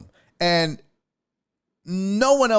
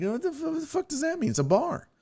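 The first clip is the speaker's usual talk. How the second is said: incredulous; 14 semitones higher, 6 dB quieter than usual. Retold or imitated. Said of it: "I was like".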